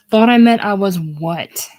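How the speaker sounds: loud, offended question; affronted outburst; indignant challenge. Acted. Leona indignantly challenges Monk.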